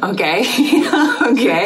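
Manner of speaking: slow, amused and baffled, through laughter; baffled laughing okay. Retold or imitated. Podcaster retelling her reaction to the baby sign, laughing bafflement. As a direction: let laughs break up a puzzled acceptance.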